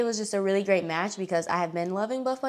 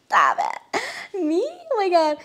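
flustered disbelief; 11 semitones higher, 5 dB louder than usual. Retold or imitated. Fan told she looks strong, loud and bashfully delighted. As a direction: play it bashful, then burst into delight.

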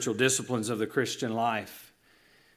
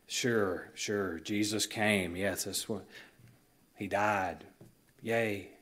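bored dismissal; 5 semitones lower, 7 dB quieter, slower than usual. Retold or imitated. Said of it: "You know".

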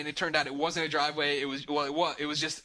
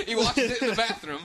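high, louder, laughing through it; can't believe it, laugh it out; 7 semitones higher, 5 dB louder than usual. Real, not acted. Telling friends about the filthy house, laughing disbelief. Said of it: "laughing disbelief at the memory".

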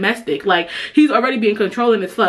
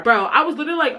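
usual speaking voice

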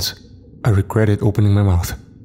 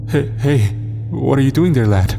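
uneasy chuckle; 5 semitones higher than usual. A narrator's reading. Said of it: "I chuckled with transparent unease".

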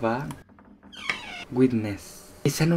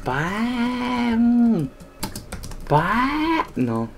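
calling the cat; 9 semitones higher, 6 dB quieter, much slower than usual. Real, not acted.

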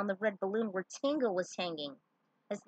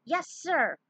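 eager recruit; 8 semitones higher, 6 dB louder than usual. Acted. Warrior answers commander.

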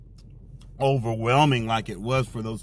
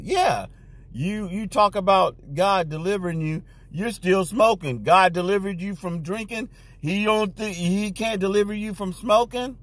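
mocking coworker; 6 semitones higher than usual. Coworker mocking his testimony.

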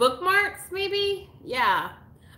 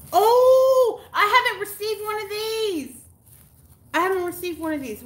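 thrilled gasp; 9 semitones higher, 5 dB louder than usual. Real, not acted. Gasping at a surprise gift.

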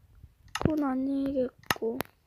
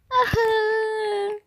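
whimper; 5 semitones higher, much slower than usual. Acted. 울먹임.

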